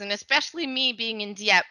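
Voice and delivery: ordinary delivery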